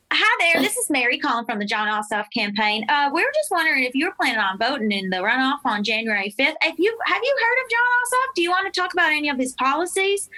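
chirpy Southern phone-banker greeting; Southern accent. Shown as a demonstration. Volunteer Mary calling about the runoff, Southern accent. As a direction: put on a Southern accent for a cold call. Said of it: "I do a Southern accent when I call".

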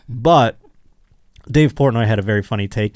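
ordinary talk

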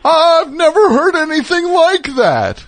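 very high, loud, over-the-top amazement; mock astonishment. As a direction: wail it in pretend mind-blown disbelief. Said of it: "amazing that there was a point where people were like".

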